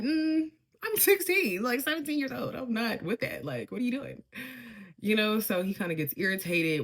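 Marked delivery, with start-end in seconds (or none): 0.0-4.2 put-on not with that, girl; mock offended teen; incredulous. Retold refusal to date.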